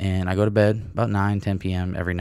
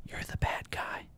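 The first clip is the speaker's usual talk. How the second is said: whispered aside; a conspiratorial whisper to end the rant; 14 dB quieter than usual. Acted. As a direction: hiss it under your breath. Whispered punchline.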